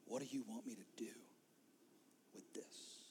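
low whispered surrender; hushed plea; whispered prayer. Shown as a demonstration. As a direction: breathe it out to Jesus. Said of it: "because you say".

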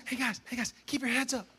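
soft, coaxing sideline encouragement; gently rally the kids; encouraging coach. Retold or imitated. Coach encouraging upset players from the sideline, softly. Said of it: "constantly be saying".

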